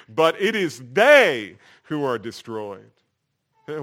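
ordinary delivery

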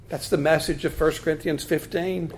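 ordinary talk

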